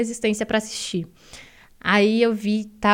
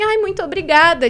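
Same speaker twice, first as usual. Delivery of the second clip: higher, louder stock thank-you; agradecimento padrão; 7 semitones higher, 6 dB louder than usual. Retold or imitated. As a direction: imitate a standard polite thanks. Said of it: "tipo, padrão". (agradecimento educado e padrão, imitado)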